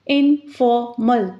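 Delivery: "Informal" slowly in three beats, in, for, mal; split into syllables. Shown as a demonstration. Teacher counting three syllables, "Informal" slowly split in-for-mal. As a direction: break "Informal" into three parts with pauses between. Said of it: "three syllables".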